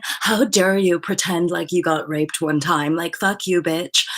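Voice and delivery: loud, slow, sneering mock rant; mock outrage. Mocking people who attack Me Too, loud sneering rant. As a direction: play a scornful hater, hamming it up.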